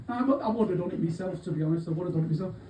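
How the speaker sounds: ordinary delivery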